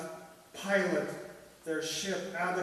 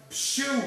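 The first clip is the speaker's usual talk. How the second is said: a hammed-up spoken sneeze, high; mock sneeze; 10 semitones higher, 9 dB louder than usual. Parrot sneezing mid-conversation, mock sneeze. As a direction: burst out a fake sneeze. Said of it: "I sneezed".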